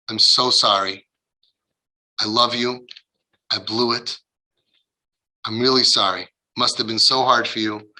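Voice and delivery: low, gentle, with space between phrases; tender apology. Acted. Parent apologizing to a hurt daughter, tender and contrite.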